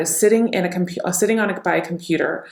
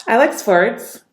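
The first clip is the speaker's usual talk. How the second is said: uplifting tone, higher in pitch; happy; 4 semitones higher, 4 dB louder, slower than usual. Shown as a demonstration. Coach demonstrating uplifting tonality, happy. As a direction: lift the voice brightly, happy and upbeat.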